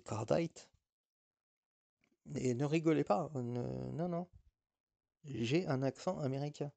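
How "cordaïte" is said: Cordite said "kor-DIGHT", with an English long i; American-style Cordite. Shown as a demonstration. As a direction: give Cordite an American ending, rhyming with "light". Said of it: "j'ai un accent un petit peu américain". (Cordite à l'américaine, « cor-daïte »)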